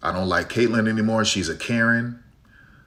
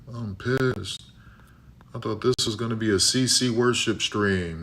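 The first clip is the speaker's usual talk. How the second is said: mock disappointed viewer. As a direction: lightly voice a viewer who wanted a Caitlin Clark worship channel. Voicing a disappointed chat viewer.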